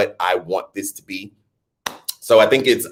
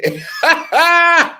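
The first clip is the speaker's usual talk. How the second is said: loud high laugh; 5 dB louder than usual. Real, not acted. Laughing at her own news voice.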